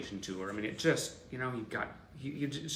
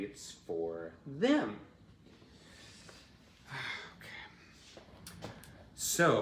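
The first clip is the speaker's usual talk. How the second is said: normal talking voice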